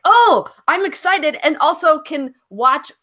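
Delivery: hammed-up thrill at finding out; mock excitement. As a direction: play up the sudden delight, slowly. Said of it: "and then I'm like".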